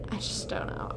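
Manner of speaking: whispered under breath; whispering. Real, not acted. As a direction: breathe the words out. Unvoiced muttered repeat.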